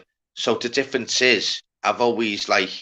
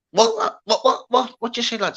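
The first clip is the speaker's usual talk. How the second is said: baffled what; 10 semitones higher than usual. Retold or imitated. Retelling his baffled reply.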